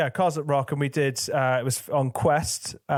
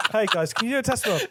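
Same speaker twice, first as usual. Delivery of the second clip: mock request; 8 semitones higher, much faster than usual.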